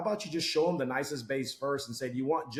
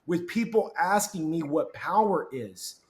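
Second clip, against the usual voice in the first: slow, complaining manager; a mild, slow complaint to the new guy; annoyed manager; 2 semitones higher, 4 dB louder, slower than usual. Retold or imitated. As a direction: drag it out like a grumbling boss. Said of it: "He's like".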